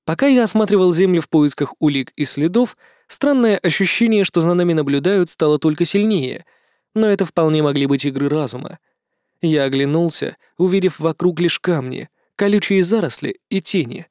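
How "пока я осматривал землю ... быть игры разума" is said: usual speaking voice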